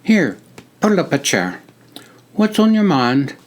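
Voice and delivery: put-on friendly greeting in a British-like accent; a soldier greets a visitor in a British-like accent; crisp British-like accent. A narrator's reading. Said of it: "in his crisp, British-like accent".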